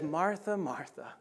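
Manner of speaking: fond sigh of a name, a hint of a chuckle; tender. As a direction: say it with loving patience. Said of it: "he speaks to her so tenderly".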